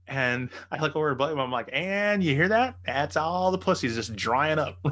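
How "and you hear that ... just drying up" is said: drawn-out, louder mock announcement, pitched up; mocking aside. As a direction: stretch it out to rib the fighter. Mocking aside to his buddy.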